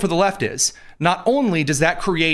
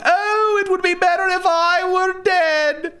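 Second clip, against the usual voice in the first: VeggieTales Jonah wailing; slower than usual. Acted. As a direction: cry out like a sulking cartoon prophet. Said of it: "I just quoted VeggieTales Jonah".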